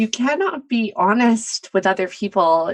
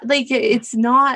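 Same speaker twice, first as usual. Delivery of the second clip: ordinary delivery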